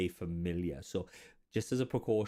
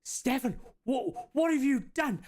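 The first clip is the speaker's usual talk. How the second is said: put-on shocked old wizard; 14 semitones higher than usual. Old bearded wizard catching his apprentice summoning. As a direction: gasp at the apprentice's mess. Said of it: "And he goes".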